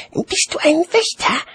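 put-on very high-pitched child voice; small child. A narrator's reading. Child questioning a watchman, high childlike voice. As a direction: ask in a high little child's voice. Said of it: "Das Kind sah zu ihm auf".

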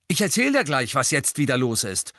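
high and loud, biting back; angry retort. A narrator's reading. Angelina jabs Harry's chest, angry. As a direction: snap at him furiously.

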